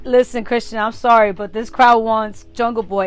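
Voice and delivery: mock announcement. Voicing promoter telling wrestler.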